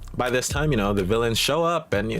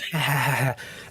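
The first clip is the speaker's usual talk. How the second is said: higher-pitched imitated villain cackle; mock villain laugh; 5 semitones higher than usual. Villain laughing while getting socked, mock cackle. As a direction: cackle like a villain taking punches. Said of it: "doing the villain thing where it's like, you laugh".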